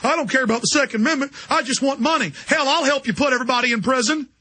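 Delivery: a hammed-up groveling gun shop owner; cowardly gun shop owner. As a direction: play the spineless owner eager to please. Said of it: "weak gun shop owners like".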